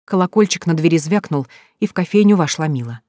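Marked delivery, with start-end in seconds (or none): none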